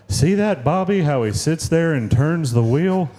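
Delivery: proud cartoon dad impression; Hank Hill. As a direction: explain it like an earnest cartoon dad. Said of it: "would be this dad".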